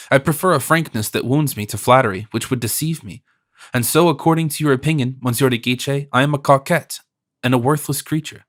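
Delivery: plain reading voice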